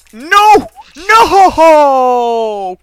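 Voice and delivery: high, loud drawn-out "no"; anguished shout. Real, not acted. Gamer reacting to a failure, anguished shout. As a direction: wail it out at a sudden loss. Fails again in the game.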